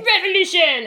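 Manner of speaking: high, loud mock French battle cry; aggressively French. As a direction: proclaim it like a hammed-up Frenchman. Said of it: "he's so aggressively French".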